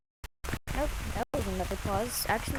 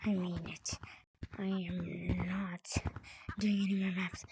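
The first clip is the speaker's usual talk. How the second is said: whispering; 3 semitones lower, 5 dB quieter than usual. Real, not acted. Gives up on maps.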